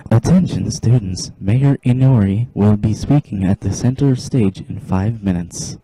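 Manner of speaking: loud, announcer-style call over the speakers; PA announcement. Acted. School PA calls the students to the stage, loud announcer read. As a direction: boom it out like a school PA.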